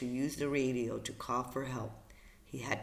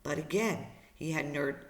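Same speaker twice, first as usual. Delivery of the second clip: usual speaking voice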